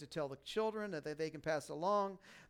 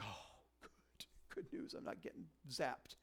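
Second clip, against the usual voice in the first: breathless, relieved whisper; 4 semitones lower, 12 dB quieter, slower than usual. Pretend. A believer relieved the angel brings good news, whispering. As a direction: whisper it like you just dodged lightning. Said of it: "we'd be like".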